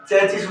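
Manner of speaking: चेचिस said "CHE-chis"; common mispronunciation. Retold or imitated. Teacher quoting how most people say it, mispronounced "che-chis". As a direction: say चेचिस with ch in both syllables. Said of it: "अधिकांश लोग इसका उच्चारण".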